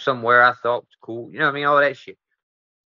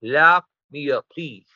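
slow plea; slower than usual. Retold or imitated. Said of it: "I'm like".

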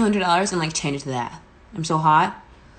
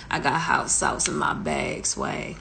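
deep, low [name] imitation, last word breathed; [name] impression; 13 semitones lower, slower than usual. Singer doing a [name] bit for her livestream fans, low and sultry. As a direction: drop way down and drawl it like a diva. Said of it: "Tell me I didn't sound like [name]".

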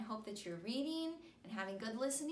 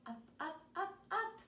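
rising up; 8 semitones higher than usual. Acted. Dogs climbing the hill.

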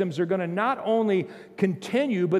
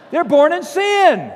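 loud and higher, hammering it home; preaching shout; 8 semitones higher, 9 dB louder than usual. Real, not acted. Pastor shouting at doubters in the pews, in full voice. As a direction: shout it at the congregation.

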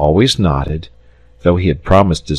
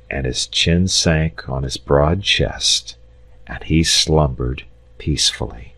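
ordinary delivery, nothing marked

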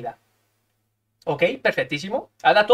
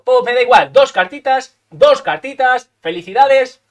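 slow, higher and louder mocking drawl; taunting the opponent mockingly; gloating taunt; 4 semitones higher, 6 dB louder, slower than usual. Real, not acted. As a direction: rub it in, slowly and loud.

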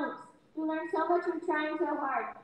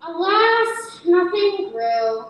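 louder, heavy "Alas"; dramatic refrain; 2 semitones higher, 9 dB louder, much slower than usual. A narrator's reading. Narrator to listeners, heavy dramatic refrain. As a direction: mourn the failure aloud.

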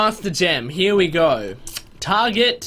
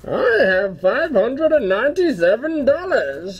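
slow, higher, hammed-up villain voice; Bane impression; 7 semitones higher, slower than usual. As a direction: boast the money like a mock Bane. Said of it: "What other impressions".

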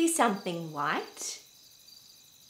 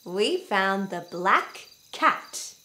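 ordinary delivery

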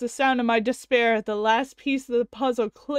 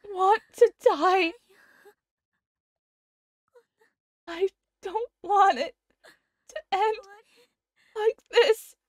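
voicing dying Kurisu; 7 semitones higher, slower than usual. Voicing Kurisu confessing about her father, tearful. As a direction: play the dying girl, pained and upset.

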